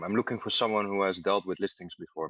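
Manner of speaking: ordinary talk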